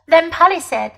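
plain reading voice

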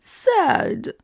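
mock tearful, trailing into a whimpering sigh; pretend near-crying. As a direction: pout and act about to cry. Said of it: "快要哭泣的样子".

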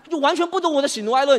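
high, loud outburst; exasperated. Acted. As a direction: burst out in frustration. Exasperated that friend missed the point.

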